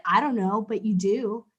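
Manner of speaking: ordinary delivery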